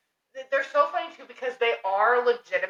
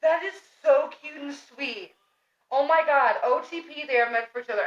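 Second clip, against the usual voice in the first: higher-pitched, hammed-up swooning over a couple; gushing fangirl; 5 semitones higher than usual. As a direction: gush as if smitten. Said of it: "fucking rat brain is like".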